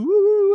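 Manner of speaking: high, loud mocking spooky woo. Mocking the fortune teller scene with a loud spooky woo. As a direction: hoot it like a cheesy mystic.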